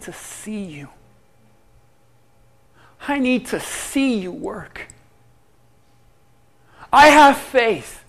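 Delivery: a believer's prayer swelling from hush to outcry; pleading prayer. Acted. Preacher voicing a believer's prayer.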